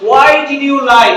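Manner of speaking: "Why did you lie" slow and loud, every word apart; slow learner pronunciation. Shown as a demonstration. Teacher presents the sentence to students, slow full "Why did you lie". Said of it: "আমরা বলি যে".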